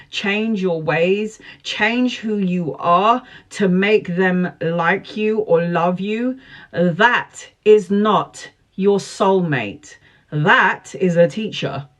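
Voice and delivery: slow, deliberate, punched words; emphatic slow preaching. Real, not acted. Tarot reader lecturing viewers on false soulmates, slow and emphatic. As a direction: hammer each word home slowly.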